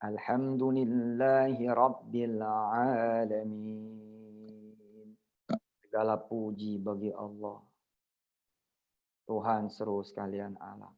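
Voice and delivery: slow, soft, devout recitation of the verse; solemn recitation. Acted. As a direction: let each word of the prayer hang, softly. Reciting the Fatihah and its meaning. (bacaan khusyuk, pelan dan lembut)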